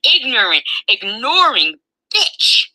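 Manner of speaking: screaming abuse at full pitch; furious screaming. Real, not acted. Daughter screaming insults at her mother, enraged shriek.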